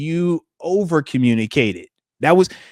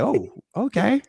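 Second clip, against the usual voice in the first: pleasantly surprised ref; 5 semitones higher, 6 dB quieter, slower than usual. Retold or imitated. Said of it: "they like".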